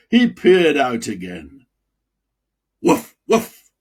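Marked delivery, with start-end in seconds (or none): 2.8-3.7 voiceless barking woof; imitated woof woof; mock dog bark.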